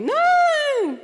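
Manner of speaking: put-on a high, loud child's protest. Child refusing therapist, high loud no. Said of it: "Aí ele".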